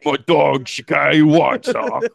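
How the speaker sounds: hammed-up Cockney for an unlikely character; Cockney British. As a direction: put on a broad Cockney accent. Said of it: "Cockney British?".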